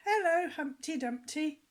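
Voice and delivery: loud, high rising chorus hello; audience call back. A narrator's reading. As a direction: shout the greeting back like a crowd of kids. Audience calls back greeting.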